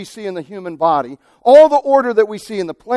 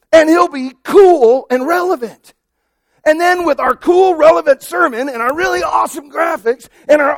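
rising higher and louder, hammed-up boasting to God; mock excited plan; 6 semitones higher, 3 dB louder than usual. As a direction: pile up the hype like a pitch. Congregation planning church to God.

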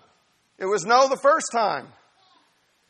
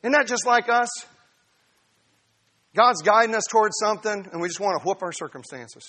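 normal talking voice

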